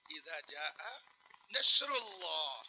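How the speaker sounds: نصر اللہ slow and drawn, نصر said nashr; shin pronunciation. Shown as a demonstration. Preacher demonstrates reading with بڑی شین, slow. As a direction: stretch نصر into نشر, very slowly. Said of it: "میں نے اسے بڑی شین سے پڑھا".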